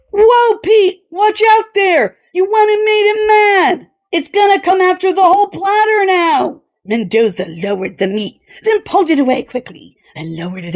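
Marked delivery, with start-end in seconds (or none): 0.1-6.6 mock alarm. A narrator's reading. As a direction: warn him with gleeful alarm. Crewmen mock-warning the cook.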